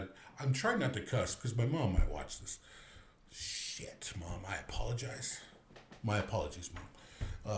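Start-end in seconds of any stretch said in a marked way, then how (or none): 3.3-4.1 whispered slip of a curse; hushed curse. Real, not acted. Caught swearing on camera, so whispered. 4.2-7.6 sheepish apology. Real, not acted. Apologizing to mom on camera.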